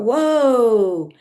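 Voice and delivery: high, drawn-out wondering exclamation; retold amazement. Mother realizing Krishna accepts her chores, retold amazement. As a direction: stretch it out in re-enacted awe. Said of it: "So I thought".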